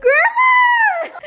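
high shrieking call; shouting. Real, not acted. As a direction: yell her name in disbelief. Calling out at grandma.

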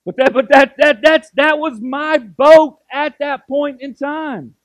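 loud, driving preacher voice, pushing each line; a pastor preaching at full force to his church. Acted. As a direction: build it like a revival sermon, loud and fervent.

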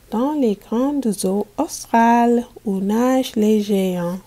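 energetic storybook reading; lively reading to children. Acted. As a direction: bring the ocean scene to life for the class. Teacher reads picture book aloud.

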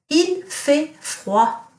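teacher quizzing class with Il fait froid; slow model sentence. Shown as a demonstration. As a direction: stretch Il fait froid out word by word. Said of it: "what does it mean if I say".

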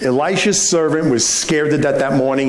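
ordinary talk

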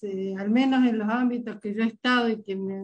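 ordinary talk, nothing marked

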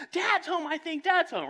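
put-on high, loud child's voice; excited kid. Child with face on window seeing dad arrive, high excited voice.

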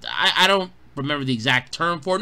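ordinary talk, nothing marked

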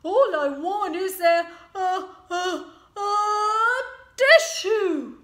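high, loud stuttering before a sneeze; building to a sneeze. Acted. Sick wolf stuttering toward a big sneeze. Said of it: "And he sneezed".

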